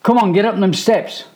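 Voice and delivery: loud, high impatient command; stern order. A narrator's reading. Mum orders the boys upstairs, loud and impatient. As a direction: hustle them up the stairs. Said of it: "their mum orders".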